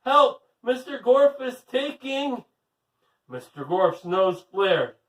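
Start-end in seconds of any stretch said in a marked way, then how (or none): 0.0-2.4 loud, high cry for help; shouted for help. Acted. Allison calling Miss Mush for help, loud high shout. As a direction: yell for help with everything you have. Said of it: "shouted Allison".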